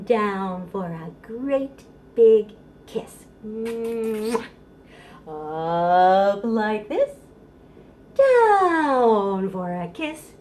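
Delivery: sing-song rhyme with a loud kiss sound; goodbye kiss rhyme. Shown as a demonstration. Mother saying goodbye to baby at child care, sing-song. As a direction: lift the voice on up, land on the kiss. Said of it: "Let's do it again".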